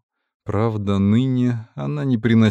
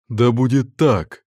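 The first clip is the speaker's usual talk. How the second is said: fervent oath; 3 semitones higher, 4 dB louder than usual. Acted. Priest swears fervent oath.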